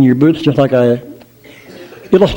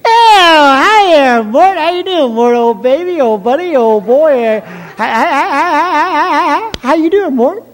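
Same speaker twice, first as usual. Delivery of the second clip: put-on much higher, gushing falsetto girl; sweet melodious voice; 12 semitones higher than usual. Mabel gushes in a high falsetto to her sweetheart Mort. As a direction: coo at him like a swooning girlfriend. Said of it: "in your sweet, melodious voice".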